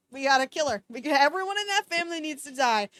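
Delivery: plain reading voice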